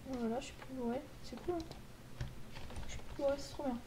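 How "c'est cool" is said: ordinary talk, nothing marked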